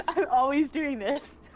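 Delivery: loud, high exclamation breaking into laughter; laughing protest. Real, not acted. Clumsy guest teased after an ow, laughing protest. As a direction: cry out, then laugh at yourself.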